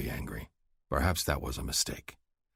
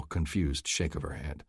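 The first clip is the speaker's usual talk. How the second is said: usual speaking voice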